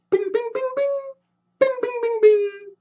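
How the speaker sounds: ping out the legs like springs; high bing sounds. Acted. Legs stretching out, sound effect.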